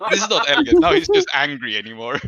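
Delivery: high and loud, laughing excitement; excited. Real, not acted. GM gleefully warning the player that the swordsman charges, high and loud. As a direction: tell it with gleeful heat.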